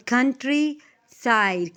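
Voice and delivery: slow कन्ट्री - साइड with a break; syllable by syllable. Shown as a demonstration. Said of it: "इसको आपने कितने दफ़ा पढ़ा".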